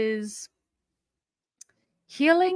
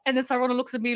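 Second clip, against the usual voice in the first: ordinary delivery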